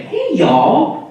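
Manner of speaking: high-pitched fake Southern greeting; fake Southern accent. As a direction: chirp it in a put-on Southern drawl. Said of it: "I assume it's fake accent".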